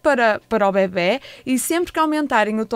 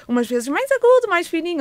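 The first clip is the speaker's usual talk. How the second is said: thin, raised little voice; high-pitched; 6 semitones higher than usual. Shown as a demonstration. Therapist showing parents pitches for baby, high-pitched. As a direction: lift the voice well up high. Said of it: "com frequências".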